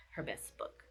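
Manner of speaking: voiceless whisper on the last words. Real, not acted. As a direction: whisper "her best book". Verdict trails into hush.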